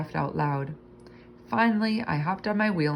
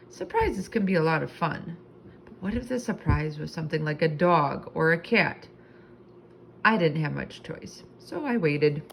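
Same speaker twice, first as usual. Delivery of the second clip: plain reading voice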